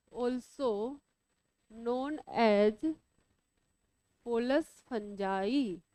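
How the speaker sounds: measured, low-key repeats, leaving time to write; slow dictation. Real, not acted. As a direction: dictate word by word for note-takers. Teacher dictating for students to write.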